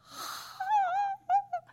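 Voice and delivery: sharp high astonished gasp. Retold or imitated. Mother hearing her son got the job, gasping in amazement. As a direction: suck in air in amazed delight.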